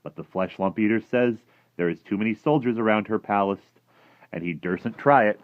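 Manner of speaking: a hint of a chatty giant; lightly voiced friendly giant, matter-of-fact. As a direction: lightly play the giant passing on gossip.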